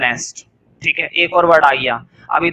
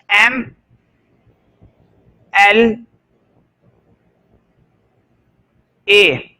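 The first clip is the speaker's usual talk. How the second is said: each letter said apart with long pauses; spelled out slowly; much slower than usual. Shown as a demonstration. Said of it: "देखो ध्यान से".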